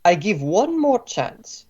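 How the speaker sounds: put-on impatient Slavic-accented warning. Boss gives captive last chance, impatient Slavic accent. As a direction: warn them in a thick Slavic accent. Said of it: "pretty good Slavic ac-, accent".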